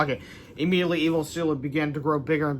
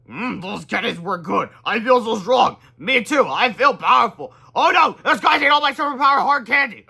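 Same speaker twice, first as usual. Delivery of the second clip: put-on high, loud old-folk voices; powered-up grandparents; 6 semitones higher, 7 dB louder than usual. Grandparents feeling candy powers, loud hammy voices. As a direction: puff up with new strength.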